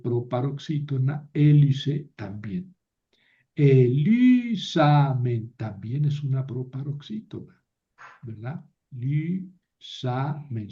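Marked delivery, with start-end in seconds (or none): 3.5-5.5 Elisamen drawn out slowly, stressed e-LI-sa-men; acento corrido a LI. Shown as a demonstration. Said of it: "el acento cambia". 8.9-10.7 Lisamen very slowly, syllable by syllable, LI-sa-men; sílabas separadas. Shown as a demonstration. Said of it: "son tres".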